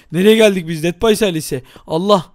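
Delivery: ordinary talk, nothing marked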